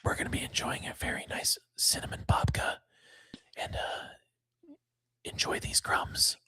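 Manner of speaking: playful, voiceless ASMR whisper; mock ASMR whisper. Host teasing stream viewers with cinnamon babka, mock ASMR whisper. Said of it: "our sub-channel A S M R channel".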